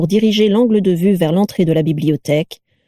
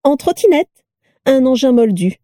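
high-pitched, proud enthusiasm; delighted boast; 6 semitones higher than usual. A narrator's reading. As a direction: show it off with bubbly delight. Vera keeps boasting of her scooter. (enthousiasme ravi, voix aiguë)

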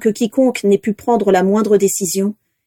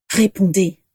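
muffled order. A narrator's reading. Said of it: "d'une voix sourde".

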